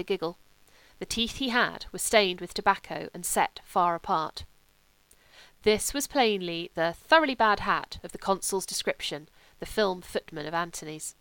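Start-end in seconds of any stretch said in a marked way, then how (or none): none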